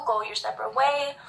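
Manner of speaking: ordinary talk, nothing marked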